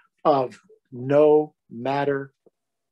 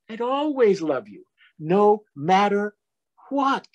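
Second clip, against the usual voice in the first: loving reassurance; 7 semitones higher than usual. Acted. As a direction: lean into what like a hug. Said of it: "said Large".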